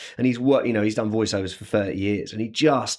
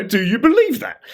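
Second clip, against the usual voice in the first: probing, higher-pitched questioning; interviewer impression; 11 semitones higher, 4 dB louder than usual. Impressionist mimicking a man asking questions with pencil and pen, probing voice. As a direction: put the question with pointed emphasis. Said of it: "he's asking you a question".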